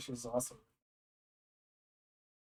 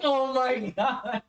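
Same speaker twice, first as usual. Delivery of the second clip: slow, laughing oh my god, higher; laughing disbelief; 8 semitones higher, 4 dB louder, slower than usual. Retold or imitated. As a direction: stretch it out through laughter. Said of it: "And he goes like".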